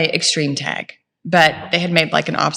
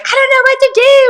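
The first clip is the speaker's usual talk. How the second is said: very high, loud frantic wail; mock-panicked wail, far above her voice; panicked husband; 6 dB louder, faster than usual. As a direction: cry it out like a helpless man in a panic. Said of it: "And Chris is like".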